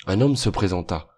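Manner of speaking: plain reading voice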